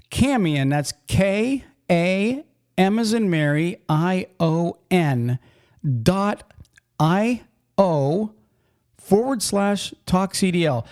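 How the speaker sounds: deliberate, spaced-out web address for listeners; slow spelling-out. Shown as a demonstration. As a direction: spell it out slowly with space between letters. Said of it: "So I'm gonna say it slow".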